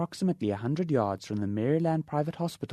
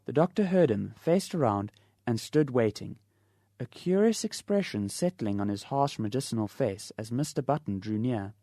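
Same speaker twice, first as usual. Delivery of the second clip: plain reading voice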